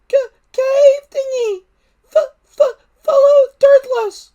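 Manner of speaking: very high, loud squeaky stutter with a crying edge; tearful stuttering dragon. Acted. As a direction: stammer urgently like a tiny dragon near tears. Dragon stutters urgent directions.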